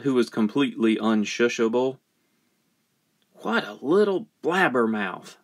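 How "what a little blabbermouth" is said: ordinary delivery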